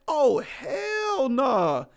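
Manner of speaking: slow incredulous hell nah; hell nah reaction. Retold or imitated. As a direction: stretch it out, refusing to accept it. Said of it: "I'm like".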